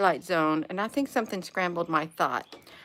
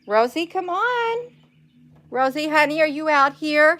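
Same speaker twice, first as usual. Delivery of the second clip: high, loud coaxing call across the yard; calling her pet; 8 semitones higher, 5 dB louder than usual. Real, not acted. Calling her pet in the yard, high loud coaxing call.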